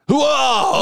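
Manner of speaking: high mock startled cry; startled whoop. Husband imitating Becky waking up, startled whoop. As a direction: imitate someone jolted awake with a whoop. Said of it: "Becky would be like that".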